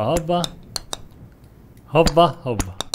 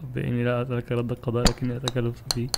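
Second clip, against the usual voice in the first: softer murmured prayer; quiet prayer; 3 semitones lower, 8 dB quieter than usual. Real, not acted. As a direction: pray softly in defeat. Quiet prayer after falling. (دعاء هادي بصوت واطي)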